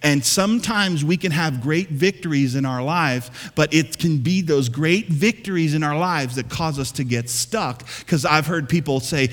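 ordinary delivery

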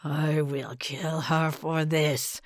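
low, slow gurgling frog voice; gurgling swamp creature. A narrator's reading. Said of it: "Bospo gurgled".

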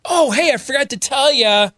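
high, breezy by-the-way; mock nice husband. Husband casually slipping in a confession to his wife, hammed-up nice. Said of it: "Did he say it nice?".

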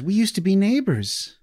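put-on high, eager little boy; small boy voice. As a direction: squeak it out like an excited child. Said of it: "and says".

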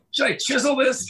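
very high, incredulous mimicry; mock sarcasm. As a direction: imitate a scoffing young employee in a high voice. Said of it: "they gave me all the sarcasm".

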